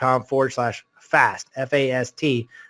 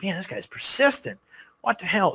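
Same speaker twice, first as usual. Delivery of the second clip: higher, hushed disbelief; mild exasperation; 6 semitones higher, 7 dB quieter than usual. Retold or imitated. Speaker reacting to a caller's third attempt, mild exasperation. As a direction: mutter it high, as a baffled aside.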